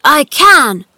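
high, loud defiant cry; indignant shout. A narrator's reading. Boy contradicting the gardener, shouting. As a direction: insist at full voice. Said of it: "he shouted".

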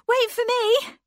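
high, loud call after her sister; girl calling out to her sister running ahead. A narrator's reading. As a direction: shout to make her stop and wait. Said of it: "cried Anne".

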